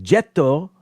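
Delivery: "Jetto" with an added final o; Japanese version. Shown as a demonstration. Explaining no word ends in a consonant, Japanese pronunciation. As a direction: say "Jetto" as two syllables, JET-toh. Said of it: "it's the Japanese version".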